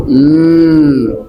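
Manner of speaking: usual speaking voice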